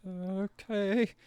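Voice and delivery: very high put-on okay; hammed-up hesitant assent. Messenger answering Sansa's odd order, hammed-up high assent. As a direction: agree in a high, uncertain messenger voice.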